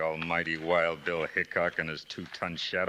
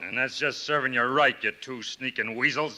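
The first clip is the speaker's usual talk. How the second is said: higher, louder taunt; gloating villain; 6 semitones higher, 3 dB louder than usual. Acted. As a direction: crow over the captured heroes. Villain gloating over heroes.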